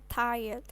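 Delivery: "tired" said TAI-yed, I as eye; English pronunciation. Shown as a demonstration. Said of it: "Makanya ini membacanya".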